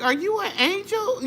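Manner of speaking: high, pleading mock-tearful voice; retold tearful question. Traveler asking her rescuer, imitated tearful awe. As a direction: ask it in wonder, as if through tears. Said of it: "through my tears".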